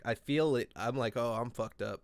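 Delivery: ordinary talk, nothing marked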